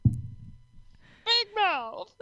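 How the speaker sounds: high cartoon teen voice from Big Mouth; Missy voice. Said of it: "It, it is a Missy voice".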